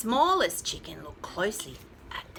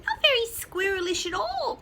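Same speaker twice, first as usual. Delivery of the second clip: rooster voice; 10 semitones higher, 4 dB louder than usual. A narrator's reading. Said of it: "crowed the rooster".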